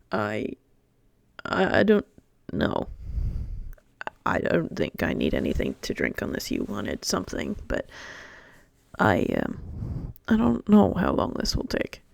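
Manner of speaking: Chifley rubs his neck, unsure how long; Chifley in character. Acted. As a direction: mumble it, hesitant.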